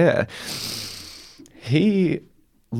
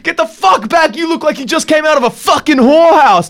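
much higher, louder imitated screaming man; furious shout; 11 semitones higher, 4 dB louder than usual. Enraged man screaming at the delivery driver to leave, furious shout. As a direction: shriek the insults like a man losing it.

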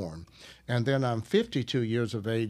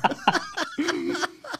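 high burst of laughter. Real, not acted. Laughs at prosperity seminar.

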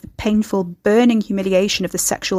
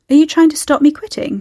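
higher, louder playful question; teasing; 7 semitones higher, 6 dB louder than usual. A narrator's reading. Carrie hides suspicion from her young supervisor, teasing. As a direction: tease to cover a touch of suspicion. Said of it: "she teased".

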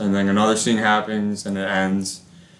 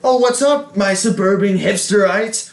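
put-on high, loud sarcastic teen voice; mock Juno; 8 semitones higher, 4 dB louder than usual. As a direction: ham up a snarky teenager, pitched high. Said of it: "Juno shows up and is like".

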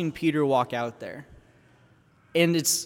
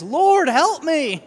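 loud, high, drawn-out plea for rescue; crying out; 11 semitones higher, 7 dB louder, much slower than usual. Acted. Sinking Peter crying to Jesus, loud desperate plea. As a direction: cry it out slowly at the top of the voice. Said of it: "He cries".

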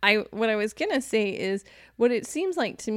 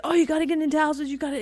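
higher, fast buy-now gushing; eager hype voice; 7 semitones higher, faster than usual. Retold or imitated. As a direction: sell it like housing-bubble hype. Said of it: "everybody said".

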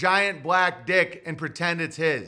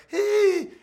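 yippy little dog; 12 semitones higher, 4 dB louder than usual. Retold or imitated. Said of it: "a little Pomeranian comes up like".

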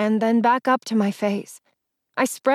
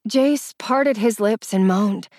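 usual speaking voice

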